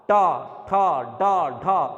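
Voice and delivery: टा ठा डा ढा said loudly with the tongue curled back; retroflex syllables shown. Shown as a demonstration. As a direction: curl the tongue and call out टा ठा डा ढा. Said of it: "बोल के देखिए".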